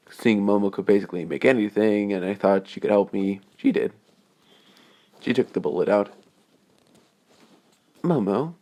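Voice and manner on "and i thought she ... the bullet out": plain reading voice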